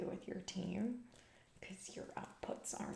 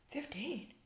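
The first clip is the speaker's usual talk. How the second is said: startled, higher-pitched echo of the number; surprised; 7 semitones higher than usual. Acted. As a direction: react taken aback, pitch jumping up. Learns how many were cut.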